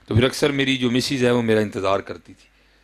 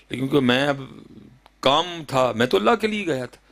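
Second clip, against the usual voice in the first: normal talking voice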